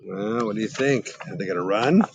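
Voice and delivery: ordinary delivery, nothing marked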